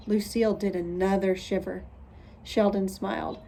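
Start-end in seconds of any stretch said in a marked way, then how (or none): none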